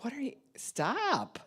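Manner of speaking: mock protest. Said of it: "they're like".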